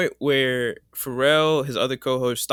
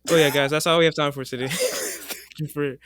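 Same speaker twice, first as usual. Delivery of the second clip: mock sign-off; 3 semitones higher than usual. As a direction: wrap up like a host.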